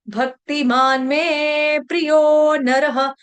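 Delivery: भक्तिमान में प्रियो नरः chanted very slowly; slow recitation. Shown as a demonstration. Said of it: "भ भालू वाला".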